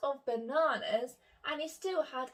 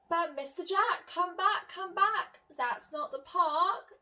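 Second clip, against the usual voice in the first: higher calling after him; 6 semitones higher, 3 dB louder than usual. Acted. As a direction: call him back, with a laugh. Miss Jones calling again.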